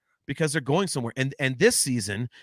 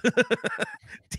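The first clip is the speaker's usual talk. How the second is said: high squeal laugh. Real, not acted. Laughing at the joke.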